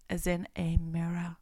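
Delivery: breathy unvoiced whisper of the verse; soft whispered scripture line. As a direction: let the words fall into a hush. Reading scripture, drops to whisper.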